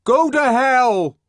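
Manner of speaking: loud, slow furious shout; exasperated bawl. A narrator's reading. The savage bawling at Bernard through the door, furious. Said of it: "bawled the exasperated voice from within".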